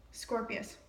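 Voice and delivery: usual speaking voice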